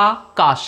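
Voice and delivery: আকাশ broken as আ + কাশ; split into syllables. Shown as a demonstration. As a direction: say Akash in two beats with a gap. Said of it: "দুটো সিলেবেলে ভাগ হচ্ছে". (আকাশ শব্দটা আ আর কাশ, দুই ভাগে থেমে)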